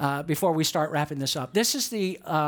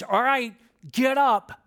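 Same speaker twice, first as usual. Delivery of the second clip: acted command; 5 semitones higher, 3 dB louder, slower than usual. Voice from heaven commands Peter.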